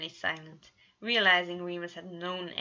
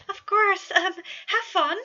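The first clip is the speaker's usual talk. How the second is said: high, loud, dazed yes; stunned agreement; 13 semitones higher, 11 dB louder than usual. A narrator's reading. Patton, still in shock, gives his blessing with a high cry. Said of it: "Patton was still in shock".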